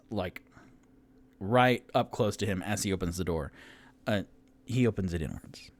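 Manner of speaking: ordinary talk, nothing marked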